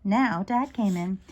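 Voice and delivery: plain reading voice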